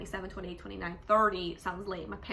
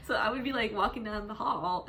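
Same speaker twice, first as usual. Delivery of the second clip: ordinary talk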